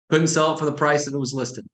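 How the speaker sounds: normal talking voice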